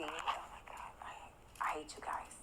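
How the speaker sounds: soft scared whisper at chat. Real, not acted. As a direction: whisper it so nothing hears you. Scared streamer mutters at chat.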